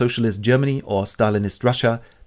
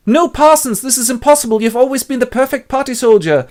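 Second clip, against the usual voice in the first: much higher, louder shocked voice; shocked Winston; 13 semitones higher, 6 dB louder than usual. Acted. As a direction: cry out in disbelief. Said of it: "and he goes".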